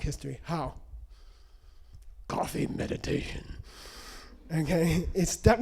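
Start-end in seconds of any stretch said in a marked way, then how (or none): 2.3-3.4 hushed whisper, like sharing a secret. Real, not acted. Teacher revealing his secret to the students, whispered. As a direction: whisper the answer after a pause. Whispered answer to own question.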